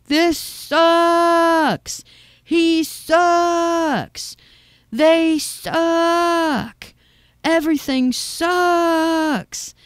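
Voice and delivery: high, loud heckler's yell with long drawn-out "sucks"; kid yelling out. Acted. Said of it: "some kid yells out".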